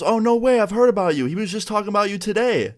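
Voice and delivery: high, eager voice of a friendly dad; excited dad. Retold or imitated. The kid's dad recognizing the YouTuber at the door, high and eager.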